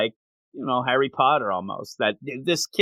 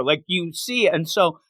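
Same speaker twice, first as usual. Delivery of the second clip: usual speaking voice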